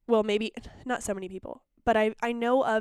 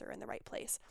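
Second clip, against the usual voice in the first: whisper; 17 dB quieter than usual. Real, not acted. Ad read trailing off.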